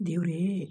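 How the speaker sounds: ದೇವ್ರೇ with the final ē stretched beyond two beats; overlong vowel. Shown as a demonstration. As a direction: draw out the end of ದೇವ್ರೇ like a call. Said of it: "ಎರಡಕ್ಕಿಂತ ಹೆಚ್ಚು ಮಾತ್ರದಲ್ಲಿ ಉಚ್ಚರಿಸುವ".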